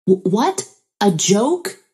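puzzled, rising questions; confused. A narrator's reading. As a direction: stammer it back in confusion, voice lifting at the end. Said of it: "I was confused and replied".

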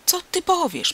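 high-pitched threatening retort; squaring up for a fight; menacing challenge. A narrator's reading. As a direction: challenge him with a touch of menace. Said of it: "zbliżył się doń groźnie".

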